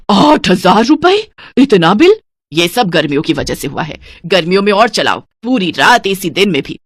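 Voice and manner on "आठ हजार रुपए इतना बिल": slow, louder shocked disbelief. Acted. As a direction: stretch it out in shock at the bill. Shocked by electricity bill. (बिल देखकर चौंककर, धीरे और ज़ोर से)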